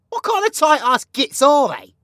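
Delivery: mock rant. Ranting about coffee.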